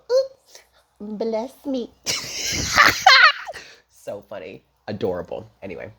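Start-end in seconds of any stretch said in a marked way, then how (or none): 0.1-1.9 high squeaky cartoon mouse; tiny cartoon mouse voice. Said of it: "And then he would go". 1.9-3.6 high cartoon giggle. Mouse giggle imitation.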